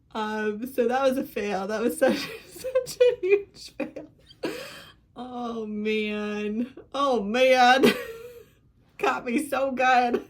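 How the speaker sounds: real tears breaking into laughter; laughing through tears. Real, not acted. Crying-laughing after finishing book.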